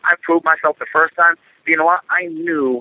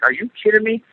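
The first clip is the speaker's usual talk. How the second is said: high, mocking disbelief; angry at rival laughing, high incredulous retort; incredulous scoff; 8 semitones higher than usual. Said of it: "and I'm like".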